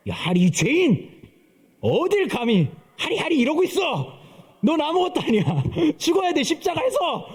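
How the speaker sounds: high, hammed-up rebuke of a sinner; mock-yell at the child like a harsh preacher; mock scolding. A pastor mocking strict church scolding his little daughter, with hammed-up high rebukes. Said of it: "막 이렇게".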